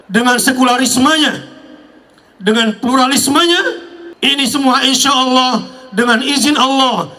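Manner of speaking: preaching. Acted. Rising sermon to crowd.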